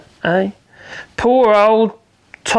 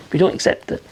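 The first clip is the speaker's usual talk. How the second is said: whispered aside; 4 semitones lower, 4 dB quieter than usual. Real, not acted. Quiet aside on society.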